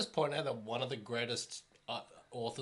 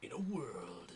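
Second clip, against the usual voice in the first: movie trailer voice; 4 dB quieter, much slower than usual. Pretend. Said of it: "I could do the movie guy voice".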